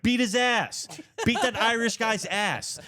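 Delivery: very high-pitched imitation of a heckler; mock egging-on yell. Bystander behind him urging a fight, high imitated yell. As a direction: goad him on in a high, drawn-out mock holler.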